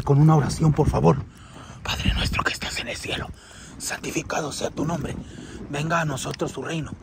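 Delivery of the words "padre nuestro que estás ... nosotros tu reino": hushed, low Padre Nuestro; murmured prayer. Real, not acted. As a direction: pray low and very soft, under the breath. Praying under breath, fearful hunt. (oración murmurada en voz baja)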